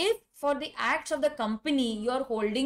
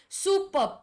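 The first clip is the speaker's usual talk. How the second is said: excited praise; 7 semitones higher than usual. Real, not acted. Praising students' answers.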